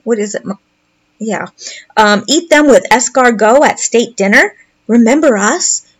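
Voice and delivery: ordinary talk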